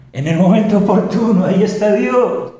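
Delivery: high, loud, rising sermon; fervent preaching. Acted. Preacher exhorting the congregation, high and loud with fervor. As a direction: preach it out with fire.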